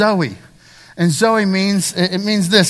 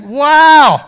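loud, high, drawn-out wow; hammed-up burst of hollow wonder; mock amazement; 9 semitones higher, 9 dB louder than usual. Preacher voicing someone who finally made that figure, mock amazement. Acted letdown after reaching the goal.

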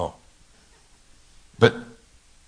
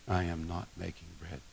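much softer, low repeat of the refusal; quiet repeat; 4 semitones lower, 13 dB quieter than usual. Acted. Jesus repeating his refusal, softer and quieter. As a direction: let it drop to a hush. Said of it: "But Jesus says".

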